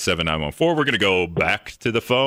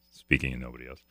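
much lower and softer, under the breath; quiet echo to himself; 8 semitones lower, 10 dB quieter, faster than usual. Real, not acted. Host puzzling over his own segue, quiet aside. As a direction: say it low, as an aside to yourself.

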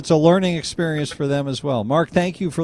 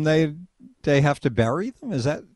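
normal talking voice

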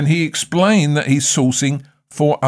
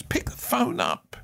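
breathy whisper, slower, much softer; whispered urging; 9 semitones higher, 9 dB quieter than usual. Shown as a demonstration. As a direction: lean in and whisper it slowly.

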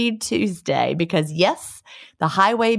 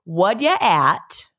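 Newfoundland greeting; much slower than usual. Shown as a demonstration. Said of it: "strangers will be speaking to you".

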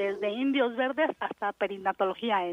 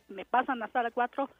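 ordinary talk, nothing marked

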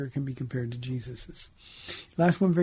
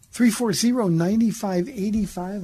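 loud, slow call-in number read; radio host calling out the number; announcing the number; 5 semitones higher, 7 dB louder, slower than usual. Real, not acted. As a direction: boom the phone number out slowly to listeners.

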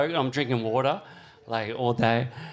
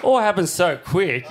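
normal talking voice

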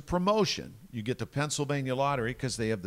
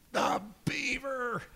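high, drawn-out showman intro; big announcer; 12 semitones higher, 4 dB quieter, much slower than usual. Retold or imitated. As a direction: stretch it out for the crowd. Announcing the guest to crowd.

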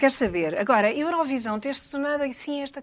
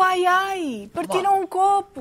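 agudo, alarme encenado por um copo partido; alarme fingido; 7 semitones higher, slower than usual. Acted. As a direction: finge um ai-ai aflito, com a voz lá em cima. (alarme a fingir, em tom agudo)

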